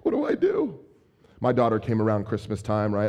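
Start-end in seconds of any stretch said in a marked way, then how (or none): 0.0-0.8 very high-pitched mock panic; freaking out. New dad freaking out over the baby, mock panic. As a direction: squeak it out like a comically overwhelmed parent. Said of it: "you're freaking out".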